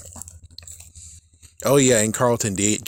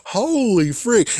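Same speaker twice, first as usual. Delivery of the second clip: much higher, drawn out; excited exclamation; 10 semitones higher, slower than usual. Real, not acted. Vlogger amazed at the signed shoes, excited. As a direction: gasp it in amazement.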